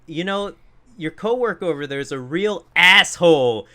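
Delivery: mock outraged complaint; imitating complaining customer. Voices customer complaining to coworker.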